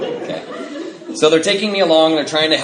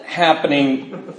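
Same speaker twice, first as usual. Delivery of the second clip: slow, dragged-out words; words pushed out through a drunken fog; struggling to speak; much slower than usual. Retold or imitated. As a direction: force the words out one by one, half laughing. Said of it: "struggling to get these words out".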